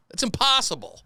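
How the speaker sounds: usual speaking voice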